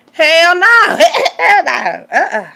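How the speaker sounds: loud high laughing hell no; cackling rejection; emphatic refusal. Real, not acted. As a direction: shout it with a laugh.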